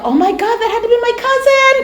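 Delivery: high, rushed burst of amazement; retold excited outburst; excited realisation. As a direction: blurt it out, voice shooting way up. Sudden realisation about her cousin.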